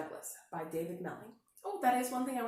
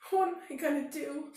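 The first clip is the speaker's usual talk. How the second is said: higher tearful question; sniffly; 6 semitones higher, 4 dB louder than usual. A narrator's reading. Bear cub sniffling to rabbit, a touch higher. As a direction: sniff through it. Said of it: "sniffed Douglas".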